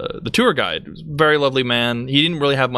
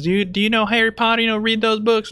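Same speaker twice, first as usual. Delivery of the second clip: much higher, fast, eager tourist voice; mocking tourist; 10 semitones higher, faster than usual. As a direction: gush it like a clueless fan. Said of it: "And she goes".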